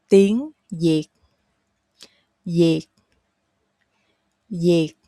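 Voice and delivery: "yệt" begun with a y, like yay; Southern spoken Y. Shown as a demonstration. Teacher gives learners a try, "yệt" with a y. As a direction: model "yệt" with a y sound, rising on "Tiếng". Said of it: "spoken way is the Y sound".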